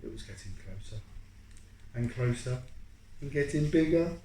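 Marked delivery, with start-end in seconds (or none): none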